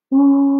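loud, high sliding woo like a roller coaster; teacher voicing the roller coaster sound; voiced slide whistle woo. Shown as a demonstration. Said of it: "So to go".

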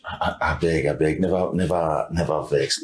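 calm them down in a low voice; placating. Acted. Man begging angry partner not to vex, low and placating.

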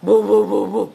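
loud punchy woofs; playful mock dog bark; imitated barking. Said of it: "Gimana suaramu gonggong, nak?".

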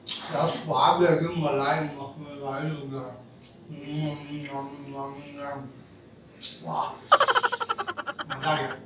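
low, soft teasing relish over each bite; mock savouring. Husband savours pizza to tease wife.

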